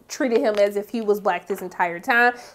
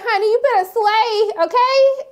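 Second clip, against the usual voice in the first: high, sassy cheering; emphatic approval, pitched way up; hyped-up praise; 9 semitones higher than usual. Retold or imitated. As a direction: hype up a friend's fabulous look. Quotes her own cheering of Cliff.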